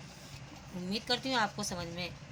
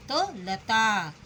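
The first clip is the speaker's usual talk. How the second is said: slow; 4 dB louder, much slower than usual. Shown as a demonstration. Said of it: "अब यहाँ अंतिम अक्षर".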